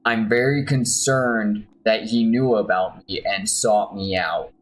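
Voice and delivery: ordinary delivery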